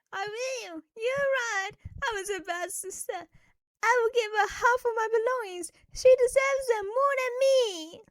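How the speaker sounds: high, teary voice breaking into a cry; spoiled sister apologising to the kidnappers in tears; tearful apology. Acted. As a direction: apologise while crying. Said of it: "Jane was crying and begging".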